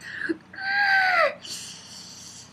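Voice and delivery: high shrieking gasp, hammed up; theatrical high-pitched shriek; mock horrified gasp. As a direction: gasp into a squeal of fake outrage. Acts out reaction to the audiobook.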